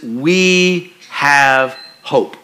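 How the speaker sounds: very slowly, "we have hope" with weight on "hope"; slow, stressed on hope. Shown as a demonstration. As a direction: let "hope" land, with no D at the end. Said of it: "takes the D off the end of hope".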